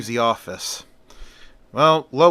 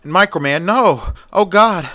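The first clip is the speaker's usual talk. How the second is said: high, loud cry of horror; raised-voice acted scream; 6 semitones higher, 5 dB louder than usual. A narrator's reading. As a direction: cry out as he is about to die. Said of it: "Lois screams".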